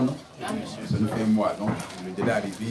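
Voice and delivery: usual speaking voice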